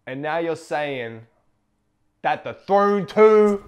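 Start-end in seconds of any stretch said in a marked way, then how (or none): 2.2-3.6 building hype. Acted. Hyping up announcement.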